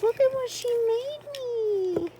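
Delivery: high, fast thrilled gush; gushing over a handmade present; excited squeal. Real, not acted. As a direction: squeal it out as you spot the gift. Thrilled opening a swap gift.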